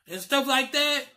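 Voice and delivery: ordinary talk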